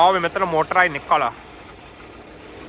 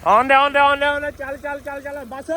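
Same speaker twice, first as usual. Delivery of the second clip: loud, high shouts to the crew; calling out; 8 semitones higher, 5 dB louder than usual. Real, not acted. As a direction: call out the pull, loudly. Calling out while pulling motor.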